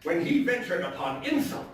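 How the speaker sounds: dramatic, theatrical storytelling voice; story narration. A narrator's reading. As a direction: read it like a gothic tale told by the fireside. Dramatic reading of Poe story.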